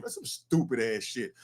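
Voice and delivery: flat, dismissive repeat; blunt street verdict. Real, not acted. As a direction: drop it as a final judgment. Repeating verdict to the lawyer Bruce.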